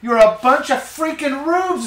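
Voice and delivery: high, drawn-out mocking scorn; mock contempt. Host voicing the politician insulting voters, contemptuous. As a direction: stretch it out slowly in mock disdain. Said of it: "is what he wants to say".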